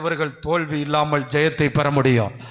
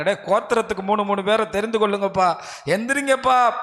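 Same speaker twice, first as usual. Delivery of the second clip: rallying call; 5 semitones higher, 5 dB louder than usual. Acted. Joshua rallying the tribes, quoted.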